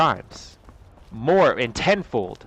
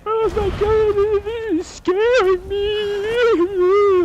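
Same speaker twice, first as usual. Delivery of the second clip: much higher, very slow whiny mock fear; mocking whine; 6 dB louder, much slower than usual. Mocking the victim's fear, whiny high squeal. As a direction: squeal it like a scared child, mocking. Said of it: "I never went".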